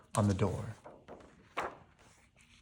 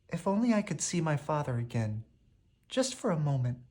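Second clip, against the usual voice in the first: wistful; 4 semitones higher, 6 dB quieter than usual. A narrator's reading. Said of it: "Belle answered".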